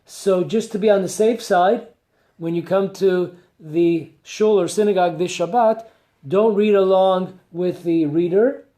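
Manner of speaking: ordinary talk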